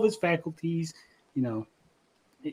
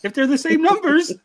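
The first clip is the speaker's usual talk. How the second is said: गुस्से में ऊँची आवाज़ में चिल्लाना; बहुत ऊँची और ज़ोरदार झल्लाहट; exasperated yell; 10 semitones higher, 6 dB louder than usual. Retold or imitated. Yelling at dad about card numbers.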